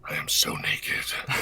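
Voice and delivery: slow whispered menace; mock Omen whisper. Joking for chat in character, slow whisper. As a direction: whisper the joke darkly.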